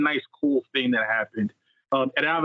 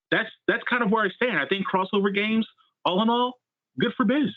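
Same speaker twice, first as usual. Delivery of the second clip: upbeat wrap-up; 7 semitones higher than usual. Real, not acted. Wrapping up his opinion with a flourish.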